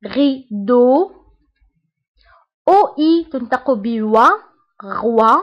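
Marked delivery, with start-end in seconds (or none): 0.1-1.1 غيدو drawn out very slowly; slow demo. Shown as a demonstration. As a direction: stretch غيدو for the learners. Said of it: "تنطق ب أو".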